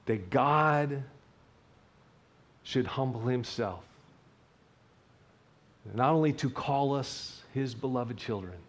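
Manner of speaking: ordinary delivery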